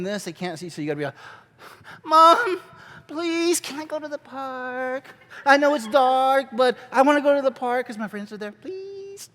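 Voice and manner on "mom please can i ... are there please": put-on high, pleading child voice; exaggerated little kid. Shown as a demonstration. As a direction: beg mom in an overdone kid whine. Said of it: "you gotta over exaggerate".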